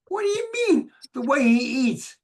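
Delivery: very high, incredulous question; can't believe what he hears; baffled. Retold or imitated.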